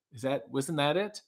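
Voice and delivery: ordinary talk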